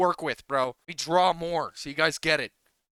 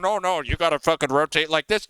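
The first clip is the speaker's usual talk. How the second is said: mocking know-it-all; 6 semitones lower, 3 dB louder than usual. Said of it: "Those same motherfuckers will have an ego and be like".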